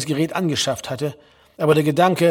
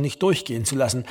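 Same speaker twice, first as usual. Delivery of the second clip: ordinary delivery, nothing marked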